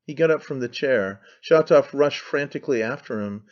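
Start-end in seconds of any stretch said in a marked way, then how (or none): none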